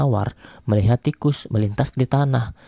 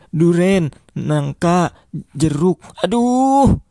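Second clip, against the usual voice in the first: put-on higher, louder kid rushing then giving up; flustered pupil; 6 semitones higher, 4 dB louder, slower than usual. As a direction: rattle off fruits and groan aduh. Pupil fails quiz, gives up.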